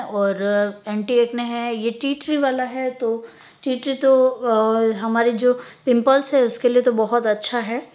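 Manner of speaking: talking through a face mask; wearing a sheet face mask. Real, not acted. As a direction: lips framed by mask. Seen 2: Wet sheet mask covers her whole face while talking, mouth hole open.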